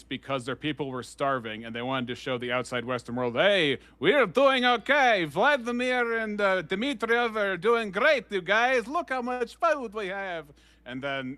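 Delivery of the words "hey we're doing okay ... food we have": high, slow mock Soviet accent; Borat-like accent. Propaganda for the Western world, high Borat-like accent. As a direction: draw it out in a hammy Borat-style accent. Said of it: "uh, Borat there".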